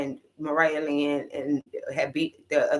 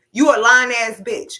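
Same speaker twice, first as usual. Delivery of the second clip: higher and louder, yelled right at her; mock loud call-out; 6 semitones higher, 7 dB louder than usual. Finally calling her sister a liar, in a mock loud yell. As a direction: throw it at her like a hammed-up yell. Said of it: "I was waiting on Taseki to say".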